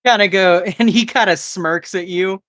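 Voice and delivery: normal talking voice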